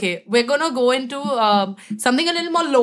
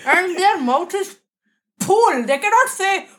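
Bengali accent, higher, slower, F said as P; Bengali-style English accent; 7 semitones higher than usual. Shown as a demonstration. Coach demos a Bengali English accent. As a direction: Bengali English accent, F turned to P, slow and high. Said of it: "placement in the mouth".

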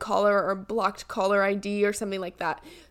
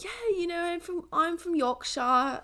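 higher, softer mock Yorkshire voice; put-on Yorkshire accent; 7 semitones higher, 4 dB quieter than usual. Telling strangers she's from somewhere else, put-on Yorkshire accent. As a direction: pretend to be from Yorkshire, higher and drawn out. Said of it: "go out and kind of, like".

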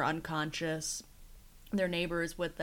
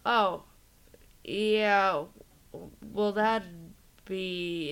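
trailing off; 4 dB louder, much slower than usual. Retold or imitated. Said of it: "he just trails off and leaves".

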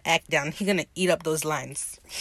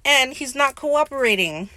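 higher-pitched mock tattling; whiny complaint; 6 semitones higher, 4 dB louder than usual. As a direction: imitate someone complaining to a partner for help. Retold complaint after failed threat.

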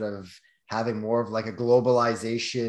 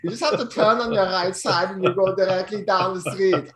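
loud, higher hammed-up German accent; imitated German accent; 7 semitones higher, 6 dB louder than usual. German local giving directions, mock German accent. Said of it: "all had an accent".

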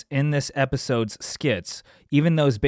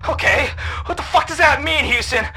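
angry panic; 6 semitones higher, 3 dB louder than usual. Acted. Angry at Houston.

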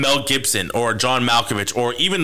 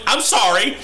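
mock apology; 11 semitones higher, slower than usual. Apologising to the Scottish lady.

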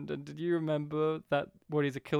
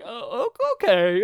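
perplexed okay; 5 semitones higher, 8 dB louder than usual. Retold or imitated. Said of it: "like, perplexed".

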